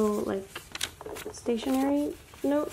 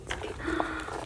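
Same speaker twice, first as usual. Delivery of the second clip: soft gasp; 5 semitones higher, 11 dB quieter than usual. Real, not acted. Opens another card.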